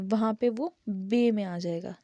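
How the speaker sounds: normal talking voice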